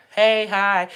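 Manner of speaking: bright called-out hey, hi; loud greeting. Retold or imitated. Calling out to friends by the pond, loud. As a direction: call out hello across the lawn.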